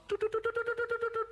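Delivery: high, rapid run of toots; tooting. A narrator's reading. Narrator describing spotted trousers, high playful tooting. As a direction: toot like a little horn on one note.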